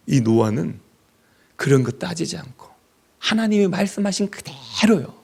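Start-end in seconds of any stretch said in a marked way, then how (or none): 3.2-5.1 whispered emphasis. Acted.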